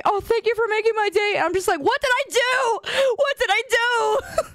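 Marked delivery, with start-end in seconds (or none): 0.0-1.4 high, sugary imitation of thanks; mock gushing viewer. Viewer thanking the streamer, mock gushing. 1.8-4.4 high-pitched, whiny disbelief; mock bewilderment. Streamer baffled by thanks, mock bewilderment.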